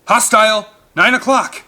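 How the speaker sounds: loud, high alarm shout. A narrator's reading. Pilot warning the crew of a threat, shouting. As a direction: bark the warning over the rotors. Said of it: "the pilot shouted".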